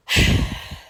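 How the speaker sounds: long exhaled sigh; heavy sigh. Real, not acted. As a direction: let the breath go. Sigh after summing up losses.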